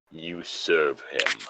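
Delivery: slow, low and soft, menacing; hushed sinister voice; ominous message. Acted. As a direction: breathe it out like a dark omen.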